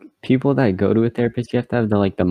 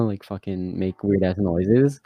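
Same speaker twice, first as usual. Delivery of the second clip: normal talking voice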